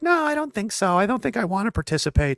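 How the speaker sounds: mocking a timid, high little voice; meek refusal. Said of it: "it's not enough to just be like".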